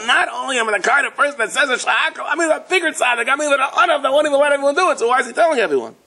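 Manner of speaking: high sing-song mockery with a laugh; mock boastful tzaddik. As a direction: send up a smug show-off of humility. Mocking a boastful humble man.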